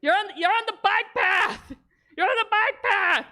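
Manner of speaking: high, bell-like ringing then a mock yell; hammed-up bike-bell chant and bike path scolding; Gord ringing and yelling. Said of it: "He's like".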